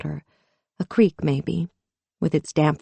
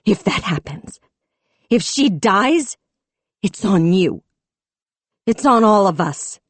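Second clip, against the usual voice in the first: tearful fury; 4 semitones higher, 4 dB louder than usual. A narrator's reading. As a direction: hold back tears and accuse. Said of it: "a shuddering breath, tears".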